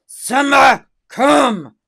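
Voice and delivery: loud, high call of the dog's name; a firm loud recall; commanding call. Shown as a demonstration. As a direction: call the dog sharply. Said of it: "your tone of voice when you say".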